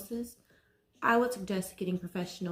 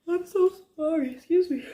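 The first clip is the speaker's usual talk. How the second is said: real, higher, louder, voice catching in tears; nervous near tears; 9 semitones higher, 5 dB louder than usual. Real, not acted. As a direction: apologize while nerves overwhelm you. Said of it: "makes my nerves so bad".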